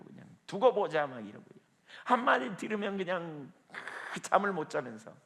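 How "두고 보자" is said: louder, higher grudge-bearing threat; acted vengeful threat. Preacher voicing someone bitter over a slight, vengeful threat. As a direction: throw out a mock vow of revenge. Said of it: "뿌득뿌득 이를 갈고".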